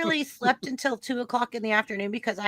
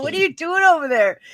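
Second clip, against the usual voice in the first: higher, louder mock astonishment; retold surprise; 7 semitones higher, 6 dB louder than usual. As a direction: replay being taken aback, pitched up and louder. Retelling her surprise at his remixing.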